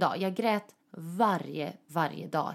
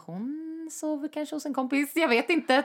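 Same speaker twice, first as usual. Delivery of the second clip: higher, slow, unsure mother voice; flustered mother; 8 semitones higher than usual. Retold or imitated. Mother covering for her daughter to the ex, higher and unsure. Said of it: "Och hon bara".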